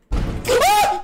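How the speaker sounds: sudden high, loud shriek; startled scream. Real, not acted. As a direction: scream out in fright. Startled by bird at window.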